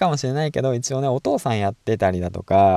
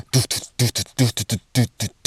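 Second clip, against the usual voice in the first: quick playful doo-doo-doo run; rapid doo doo jingle; much faster than usual. Retold or imitated. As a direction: rattle off a fast doo-doo sound. Imitating the follower's jingle.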